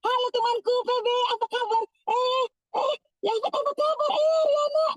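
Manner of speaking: high, beaming hello to a church friend; overjoyed to see a friend at church; cheerful friendly greeting. Shown as a demonstration. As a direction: greet your friend with a big smile in your voice. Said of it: "ke gereja pun harus ramah".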